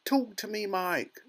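high, urgent mother; pleading cry. A narrator's reading. Mother begs tiny son to speak, crying out. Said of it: "cried Missus Teavee".

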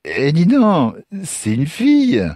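put-on high, loud drunken surprise; drunk young man. Drunk stranger discovers Lucas carries a girl, surprised. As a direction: exclaim it tipsily, dragging the end.

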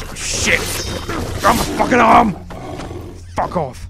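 high, loud alarmed shouting; panicked yell. Real, not acted. Player grabbed by an animal in the game, panicked yelling. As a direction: shout while fighting something off.

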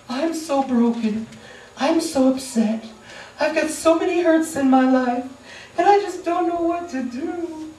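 lightly voiced needy person whining; needy whiner. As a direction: pile on the woe.

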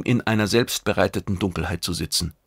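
plain reading voice

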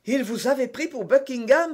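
exclaiming. A narrator's reading. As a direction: cry out in surprise at the mistake. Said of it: "s'écria d'Artagnan". (exclamation vive)

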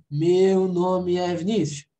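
overcareful textbook reply; stilted Portuguese. Shown as a demonstration. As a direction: drag out "Meu nome é Vinícius" like a drill. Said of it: "Nem no português você fala isso".

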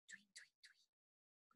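slow breathless whispered tweets; tiny whispered birdsong. Shown as a demonstration. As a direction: barely whisper the chirps. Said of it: "but much quieter than before".

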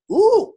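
a sudden high, loud ooh of delight; excited high ooh. Acted. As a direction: gasp out a thrilled ooh. Excited at receiving light.

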